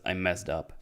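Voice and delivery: low, quiet retold admission; understated, deflated quote; subdued confession. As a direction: drop the voice as if owning up. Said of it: "takes a heavy breath and only says".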